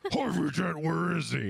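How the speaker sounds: loud gruff Batman growl. Retold or imitated. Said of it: "She's like Batman".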